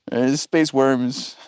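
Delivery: savouring the phrase "space worms"; slow stress on space. Shown as a demonstration. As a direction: space worms said slowly, SPACE leaned on. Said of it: "Did you say space worms?".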